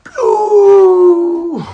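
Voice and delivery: very high, loud drawn-out whoosh; long shrieking hoot of a projectile; imitated flying cannonball.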